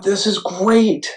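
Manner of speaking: softly gushing this is great; delighted thought. Retold or imitated. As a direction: relive the moment of joy. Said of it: "And I thought".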